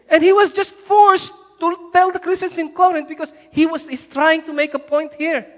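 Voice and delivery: forceful, high-pitched exhortation; impassioned preaching. Real, not acted. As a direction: drive the point home to the church. Preacher drives the point home.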